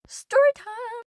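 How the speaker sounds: high, bright sing-song call; excited announcement. Acted. Vlogger kicking off story time, excited sing-song. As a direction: announce it like a show opener.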